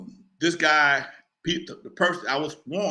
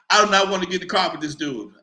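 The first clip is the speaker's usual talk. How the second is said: ordinary talk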